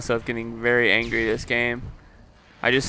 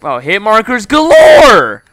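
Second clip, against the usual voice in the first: high, loud, slow triumphant yell; real delighted outburst; excited shout; 10 semitones higher, 13 dB louder, slower than usual. Real, not acted. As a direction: bellow it with glee.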